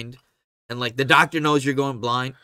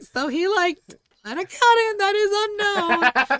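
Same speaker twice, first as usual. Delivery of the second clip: high, slow mock wife voice; high drawn-out wife impression. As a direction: parody her in a high squeak. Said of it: "and then Bridget's like".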